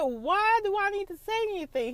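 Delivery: high, defiant retort; a lightly hammed defiant wife; defiant question. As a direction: toss it back high and defiant. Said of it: "I was like".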